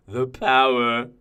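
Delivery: slow, hushed relish at normal pitch; restrained, drawn-out gloat; savouring power. Acted. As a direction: linger on it, contained and satisfied. Savours the victim's death.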